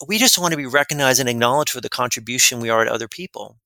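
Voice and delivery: ordinary delivery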